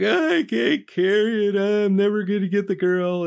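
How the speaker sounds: high, whining complaint; whiny Koa. Retold or imitated. Koa complaining to Buddha about the bamboo, in a high whine. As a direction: whimper it like a hopeless young man. He goes back.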